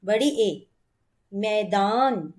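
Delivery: ordinary talk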